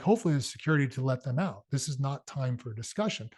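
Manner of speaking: normal talking voice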